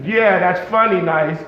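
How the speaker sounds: ordinary talk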